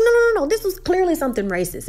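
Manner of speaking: light acted indignation, starting high; retold heated objection. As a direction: object in a quick rush of high no's. Acted argument, Molly objects firmly.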